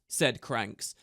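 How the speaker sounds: plain reading voice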